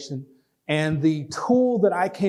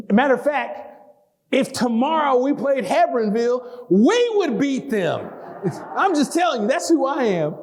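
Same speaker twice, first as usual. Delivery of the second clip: fired-up boast; 6 semitones higher than usual. Real, not acted.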